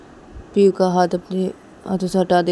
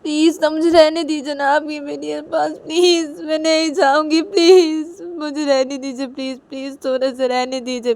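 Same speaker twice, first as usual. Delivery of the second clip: high, loud tearful begging; crying plea; 7 semitones higher, 10 dB louder than usual. Acted. Rui begging Piu to let her stay, crying. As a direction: wail and beg to be allowed to stay. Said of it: "रुई रोते रोते बोलती PU को".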